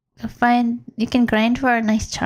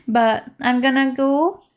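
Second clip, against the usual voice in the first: ordinary talk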